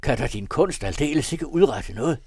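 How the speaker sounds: loud, high desperate plea; vehement shouting. A narrator's reading. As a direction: cry out, pleading for life. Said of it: "skreg han heftigt".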